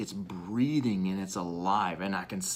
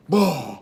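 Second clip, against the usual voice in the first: power burst shout; 5 semitones higher, 9 dB louder than usual. Retold or imitated. Muscles pop from strength.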